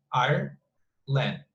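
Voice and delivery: Ireland drawn out in two beats; split into syllables. Shown as a demonstration. Teacher showing the class two syllables, split apart slowly. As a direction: say "Ireland" slowly as Ire, then land. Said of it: "it's two syllables".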